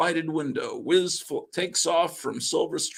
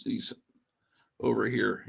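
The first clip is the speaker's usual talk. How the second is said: usual speaking voice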